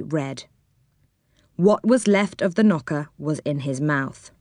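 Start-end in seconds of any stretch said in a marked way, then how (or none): none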